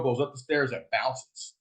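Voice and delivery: ordinary talk, nothing marked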